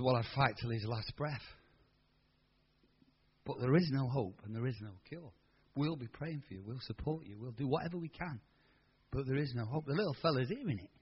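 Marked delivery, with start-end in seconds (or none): 0.0-9.8 lower, much softer sombre voice; grave doctor. Retold or imitated. Doctor telling the mother there's no hope, soft and low. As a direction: break the bad news gently.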